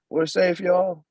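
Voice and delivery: high, happy cheer; relieved cheer. Acted. Women celebrate being safe.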